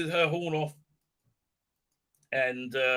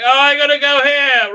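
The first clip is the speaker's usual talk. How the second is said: high, loud mock outburst; a mocking hammed-up yell of eagerness; mock exclamation; 10 semitones higher, 13 dB louder than usual. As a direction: yelp it like a cartoon. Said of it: "He's like".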